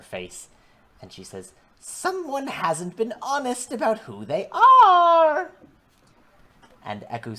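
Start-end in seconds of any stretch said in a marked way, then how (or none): 1.8-5.5 high, slow hag accusation; crone voice. Acted. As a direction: accuse them like an old witch.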